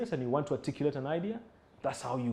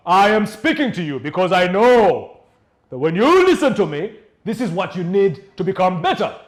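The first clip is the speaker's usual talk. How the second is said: elevated voice; 2 semitones higher, 10 dB louder than usual. Shown as a demonstration. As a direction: project it with full authority. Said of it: "people who elevate their voices".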